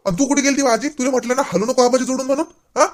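high, loud scolding of grandma. Acted. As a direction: demand to know where she went.

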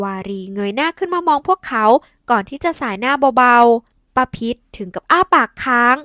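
ordinary talk, nothing marked

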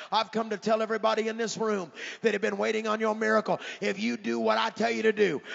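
loud, rising preacher's cadence; preaching at full force. Real, not acted. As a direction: drive the promise home to the room.

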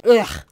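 big stretching yawn sound; loud yawn groan. Real, not acted. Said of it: "have a good yawn".

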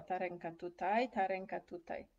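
usual speaking voice

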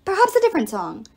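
high, loud interjection; talking arrow. A narrator's reading. Arrow objecting to Apollo's song, loud high interruption. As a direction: cut in with grand disapproval.

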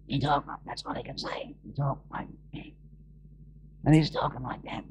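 very soft, voiceless imitation of ruined vocals; lost voice. Preacher imitating a man who lost his voice, faint and voiceless. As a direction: croak it out with almost no voice. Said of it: "it destroyed all his vocals, and he couldn't speak".

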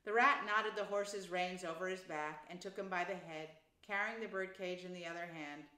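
ordinary delivery

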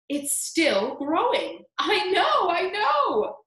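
high, loud, drawn-out delight; excited exclaiming. Acted. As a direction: squeal it in rising excitement. Both aunts exclaim in excitement.

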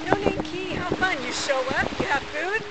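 ordinary talk

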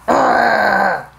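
long, drawn-out playful growl; wolf growl. Acted. Wicked wolf threatening the baker, growling.

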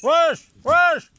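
high, loud shout to the animals; calling out loud. Real, not acted. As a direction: yell it across the field. (yüksek sesle bağırarak)

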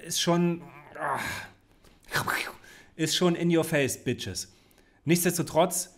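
ordinary talk, nothing marked

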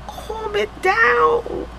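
high, slow singsong to herself; self-soothing singsong. Real, not acted. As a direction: coo it out slowly, high, to calm herself. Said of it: "Your girl needs to calm down".

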